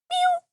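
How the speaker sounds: very high squeaked meow; high meow sound. Retold or imitated. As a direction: imitate a tiny cartoon squeak. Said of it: "You know that sound?".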